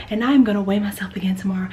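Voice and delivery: ordinary delivery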